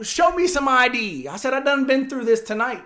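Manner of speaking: insist, worn out and loud; exasperated demand. Retold or imitated. Teller demanding ID from the fake cop, loud and fed up.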